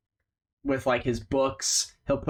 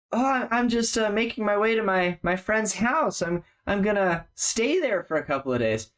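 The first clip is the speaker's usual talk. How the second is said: lightly voiced stammering nervous man, higher; hesitant, trying to brush them off; nervous traveler; 8 semitones higher than usual. GM voicing the traveler caught in the alley, nervous and stammering.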